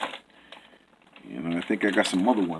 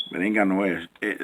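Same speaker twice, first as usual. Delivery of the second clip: ordinary talk, nothing marked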